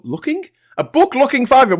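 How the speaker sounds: baffled high rereading; puzzled, high rereading. Real, not acted.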